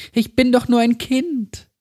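put-on high little girl's voice, trembling; high, quavering child's voice. A narrator's reading. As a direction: plead like a frightened small child. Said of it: "Jetzt zitterte die Stimme wieder".

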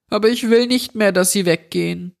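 high, fast, frightened plea; terrified boy begging the intruder to spare his parents; pleading child. A narrator's reading. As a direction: beg him in a child's panicked voice.

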